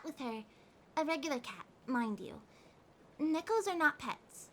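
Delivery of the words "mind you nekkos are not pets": ordinary talk, nothing marked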